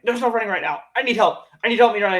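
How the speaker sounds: mock Eddie begging Venom; imitating Eddie. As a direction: beg for help. Eddie voiced, retelling.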